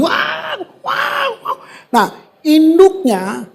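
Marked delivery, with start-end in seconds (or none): 0.0-1.6 put-on high shrieking cries of a baby eagle; eaglet screaming. Preacher voicing the falling eaglet, shrieking. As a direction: shriek like a scared chick falling. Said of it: "anaknya teriak ini".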